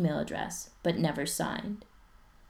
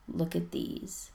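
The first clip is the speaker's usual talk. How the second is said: slow, breathy near-whisper; half-whispered, slow; mild hushed awe; 6 dB quieter, slower than usual. Acted. Ryan showing stalker photos.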